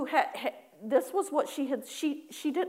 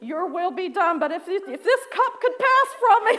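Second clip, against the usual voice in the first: high, loud hammed-up plea, breaking into laughter; pleading prayer; 7 semitones higher, 9 dB louder than usual. Worshipper praying to escape apologizing, loud mock-desperate pleading. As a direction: beg theatrically to be let off. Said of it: "I'm kinda like Jesus".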